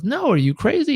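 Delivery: high-pitched, storyteller's disbelief; acted, high incredulous protest; incredulous refusal. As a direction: balk at a mad suggestion, pitch jumping up. Boy rejects being caught by tiger.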